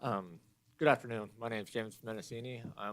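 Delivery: faint, away from the mic; speaking too softly to be picked up; too quiet. Real, not acted. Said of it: "Can't read it".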